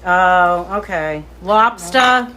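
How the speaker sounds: ordinary talk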